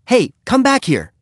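put-on high, loud boyish call; calling after runaways. A narrator's reading. Boy calling after scattering kittens.

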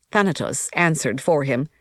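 plain reading voice

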